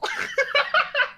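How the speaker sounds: shrill burst of laughter; high laugh. Real, not acted. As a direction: squeal with laughter. Laughs at own prayer.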